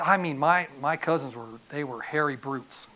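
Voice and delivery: usual speaking voice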